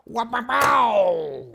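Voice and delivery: loud, high "wah-pow-pow"; playful noise-making. Pretend. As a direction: yelp out a drawn-out silly sound effect. Imitated noise while working on car.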